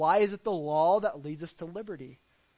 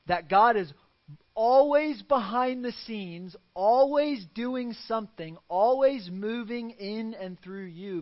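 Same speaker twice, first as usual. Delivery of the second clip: higher, louder, slowed preaching build; rising preaching; 5 semitones higher, 6 dB louder than usual. Acted. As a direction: drive each "always" home. Preaching climax.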